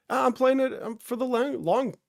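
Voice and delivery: stumbling start, losing the phrase; tongue-tied stumble. Real, not acted. Streamer fumbles his line to chat, tongue-tied. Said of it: "tongue-tied".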